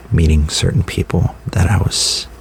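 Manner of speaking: normal talking voice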